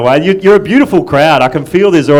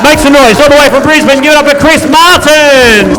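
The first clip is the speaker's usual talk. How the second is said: high, loud MC introduction; announcer's hype shout; 11 semitones higher, 7 dB louder than usual. Real, not acted. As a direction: whip the crowd up for the act. MC introduces the first act.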